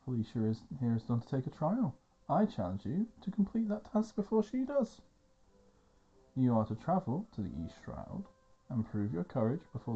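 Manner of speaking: formal, ringing proclamation; Owain issuing challenge. Acted. Owain sets the trial, formal challenge. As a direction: declare the terms grandly.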